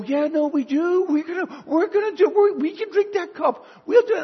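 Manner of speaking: put-on brash kids insisting they can do it; excited teenagers. Apostles insisting to Jesus, high eager teenage voices. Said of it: "you can hear them excited".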